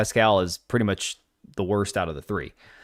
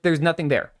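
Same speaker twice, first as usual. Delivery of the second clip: ordinary talk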